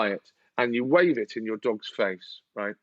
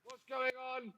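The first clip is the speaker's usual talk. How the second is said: suspicious owner; 10 semitones higher, 5 dB quieter than usual. Retold or imitated. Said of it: "You go".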